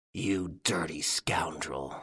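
low, soft, slow snarl through the teeth; snarled between teeth. Acted. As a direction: spit it out slowly, held-back fury. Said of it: "Ganimard snarled between his teeth".

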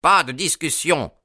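irate yelled refusal; mild yell. A narrator's reading. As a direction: cut the argument off loudly. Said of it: "hurla monsieur Sherman".

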